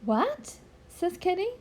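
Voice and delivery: put-on gentle storybook read-aloud for children; story narration. A narrator's reading. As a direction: read it like bedtime to little ones. Reads picture book aloud.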